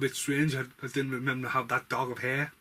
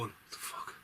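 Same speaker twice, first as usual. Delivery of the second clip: unvoiced, disbelieving; stunned whisper; 16 dB quieter than usual. Reviewer voicing a viewer's reaction to The Mist, whispered. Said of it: "The Mist leaves you going".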